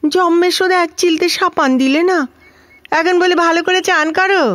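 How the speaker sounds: high, aggrieved complaint; indignant grumble. Acted. Grumbling about bathing orders from others. (উঁচু গলায় বিরক্তির গজগজ)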